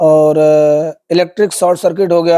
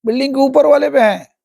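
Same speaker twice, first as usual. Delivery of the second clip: mock wail; 6 semitones higher than usual. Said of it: "जोर से चिल्लाई".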